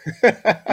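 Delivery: high, loud burst of laughter. Real, not acted.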